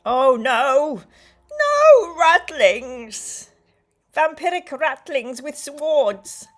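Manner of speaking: loud, drawn-out mock horror; mock dread. Reacting to the ratlings in hammed-up loud horror. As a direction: ham up the dread.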